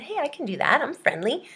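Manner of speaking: volunteer cheerfully for the job; mock eager. Student answering the waving-job ad, lightly eager.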